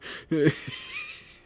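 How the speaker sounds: very high squealing burst of laughter; helpless high-pitched laugh. Real, not acted. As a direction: shriek with laughter. Cracks up at own joke.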